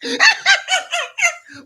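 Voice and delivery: laugh. Real, not acted. Laughing at mock quote.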